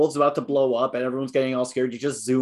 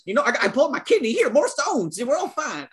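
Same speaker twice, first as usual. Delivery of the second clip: fast, much higher mock southern accent; hammed-up southern accent; 11 semitones higher than usual. Cracking a joke as the villain in a high, fast southern accent. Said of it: "that was a bad joke".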